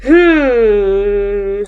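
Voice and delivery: refrigerator hum. A narrator's reading. Reader imitates the noisy kitchen refrigerator, sustained humming. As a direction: hold a humming appliance drone. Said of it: "went the refrigerator".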